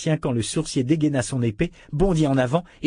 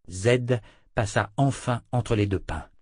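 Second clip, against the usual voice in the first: usual speaking voice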